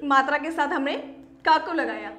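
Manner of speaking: ordinary talk, nothing marked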